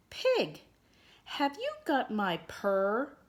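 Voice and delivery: high-pitched kitten calling out a question; kitten calling over. A narrator's reading. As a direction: call it up high like a small kitten. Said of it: "she called over the snuffling".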